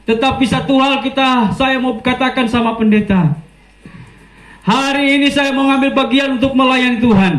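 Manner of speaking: high, earnest testimony voice; testifying prisoner. Retold or imitated. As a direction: raise the voice high, phrase by phrase. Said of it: "ada satu bapak yang bersaksi".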